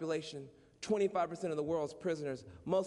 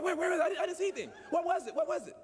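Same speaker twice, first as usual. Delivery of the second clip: mock panicked dad; 10 semitones higher, much faster than usual. Imitating the startled dad.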